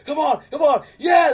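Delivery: high, loud cheering yell, rising to a shriek on yeah; excited fan screaming. Retold or imitated. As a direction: cheer like the pick just landed. Recalling screaming at the draft pick.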